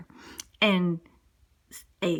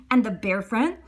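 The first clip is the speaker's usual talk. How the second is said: ordinary delivery, nothing marked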